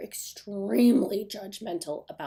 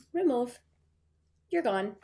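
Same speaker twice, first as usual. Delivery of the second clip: normal talking voice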